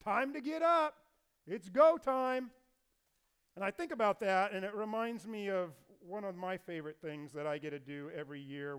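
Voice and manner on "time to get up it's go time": higher, louder taunting call; shouting across the ravine. Acted. David calls across the ravine to wake Abner, loud mocking shout. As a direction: yell it across the valley to wake the camp. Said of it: "David shouts out at the top of his voice".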